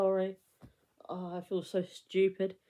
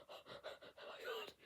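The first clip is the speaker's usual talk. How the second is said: breathless, hushed gasp of awe; gasped oh my God; 24 dB quieter than usual. Retold or imitated. To herself on spotting her childhood idol, a hushed awed gasp. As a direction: gasp it out, starstruck and barely voiced.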